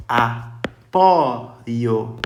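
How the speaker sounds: Apoio said loud with a long open ó; open-vowel demo. Shown as a demonstration. Teacher modelling the open ó in Apoio, loudly. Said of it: "é aberto".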